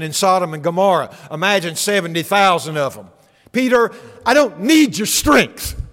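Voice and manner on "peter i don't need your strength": raised, slow, firm declaration; higher and louder, leaning hard on need; Jesus rebuking Peter. Acted. Preacher voices Jesus to Peter.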